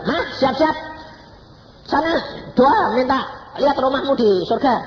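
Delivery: acted urgent call. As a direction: call out the orders loud and high. Acting out excited mother's urgent orders. (seruan mendesak bernada tinggi)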